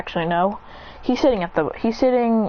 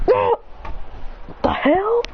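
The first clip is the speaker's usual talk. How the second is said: startled; 12 semitones higher, slower than usual. Acted. Adrian hit by a laser in the hallway, startled high yelp. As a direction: jolt up in surprise.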